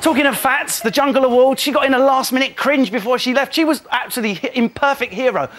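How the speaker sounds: ordinary talk, nothing marked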